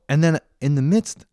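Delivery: ordinary delivery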